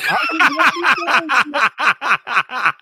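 loud, high burst of laughter; long rapid cackle; big laugh. Real, not acted. Co-host admits he didn't know, big laugh.

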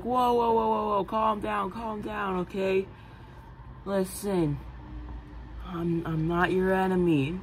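softening, reassuring tone; a touch of calm reassurance; mild calming. Acted. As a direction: settle a scared kid down. Day calming Richard.

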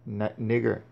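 normal talking voice

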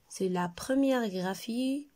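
ordinary talk, nothing marked

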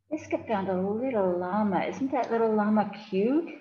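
plain reading voice

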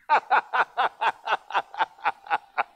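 high wheezy laugh; own laugh. Real, not acted. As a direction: burst out laughing at the pulpit. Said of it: "I laugh horrible".

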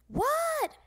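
put-on high, drawn-out kid's reply; kid calling back. Froggy answering his father, high kid holler.

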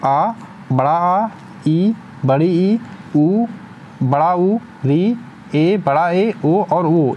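each vowel drawn out slowly and clearly for students; careful, slow spelling-out of vowels; slow vowel recital. Shown as a demonstration. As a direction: sound out every vowel deliberately, like a teacher at the board. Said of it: "ग्यारह में देखिए आपके कौन कौन से आते हैं".

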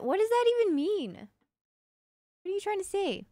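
high-pitched mock outrage; how dare you, squeaked high; mock indignant. Real, not acted. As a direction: play offended at the joke, voice jumping up. Mock offense at chat joke.

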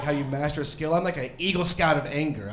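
ordinary talk

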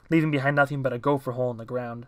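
usual speaking voice

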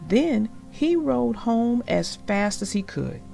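ordinary delivery

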